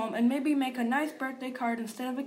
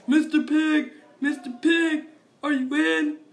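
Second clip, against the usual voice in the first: loud, higher call through the door; 6 semitones higher, 11 dB louder than usual. Retold or imitated. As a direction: call out to the pig in a loud sing-song. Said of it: "I called".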